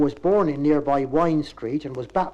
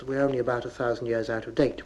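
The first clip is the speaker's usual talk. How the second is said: ordinary talk, nothing marked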